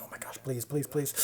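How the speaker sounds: imitating a nervous, whispered plea; half-whispered mock prayer; hushed pleading. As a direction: beg under your breath. Imitates hidden assistant director's pleading.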